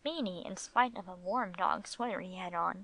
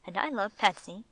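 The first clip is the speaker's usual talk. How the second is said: ordinary delivery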